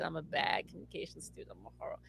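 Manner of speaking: ordinary talk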